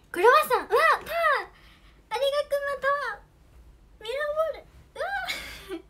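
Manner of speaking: light up at each present; excited. Real, not acted. Gifts pouring in on stream.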